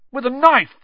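high, loud, panicked exclamation; terrified cry. Acted. Ron reports the attack in the dormitory, panicked cry. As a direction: cry it out in horror. Look of the utmost terror.

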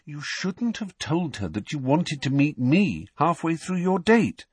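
patronising, measured correction; patient explaining. A narrator's reading. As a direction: spell it out as to a toddler. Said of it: "with the patient air".

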